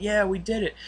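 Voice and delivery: high-pitched retold cheer; mock celebration of a group win; imitated cheering. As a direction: cheer like an excited crew. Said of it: "they're cheering".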